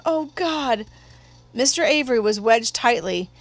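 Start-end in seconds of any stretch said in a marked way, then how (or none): 0.1-0.9 high, hushed dismay; breathed in alarm. A narrator's reading. Brother watching a man stuck in a burning house, breathed dismay. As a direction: let it out in mild horror. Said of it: "breathed Jim".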